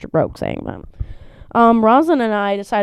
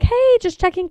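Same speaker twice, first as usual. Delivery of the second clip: much higher chirpy greeting; sing-song high hello; chirpy vet; 3 dB louder than usual. Retold or imitated. Owner imitating vet call, chirpy high. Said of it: "she was like".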